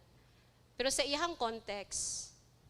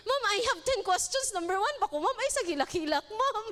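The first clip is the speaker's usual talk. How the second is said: mock impatient interviewer; 7 semitones higher, faster than usual. Mocking interviewer rushing crying participant.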